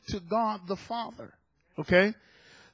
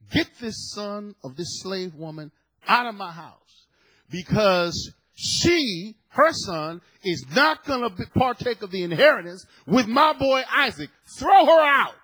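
angry Sarah; 5 dB louder than usual. Retold or imitated. Angry wife demanding her husband throw out the slave woman, building to a shout. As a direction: start firm and build into a furious mock outburst. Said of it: "She becomes angry".

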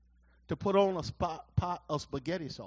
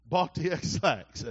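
ordinary talk

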